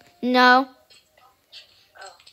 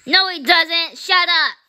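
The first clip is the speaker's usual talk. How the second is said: higher, punchy comeback; annoyed retort; 5 semitones higher, 4 dB louder than usual. Real, not acted. Kid rejecting a sibling's milk joke, annoyed retort.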